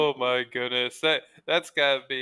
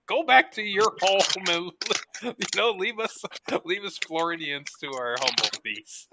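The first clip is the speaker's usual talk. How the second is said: higher, laughing mock shoo; shooing tourists; 6 semitones higher than usual. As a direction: wave the tourists off like flies, amused. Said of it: "You're like".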